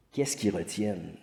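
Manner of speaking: ordinary talk, nothing marked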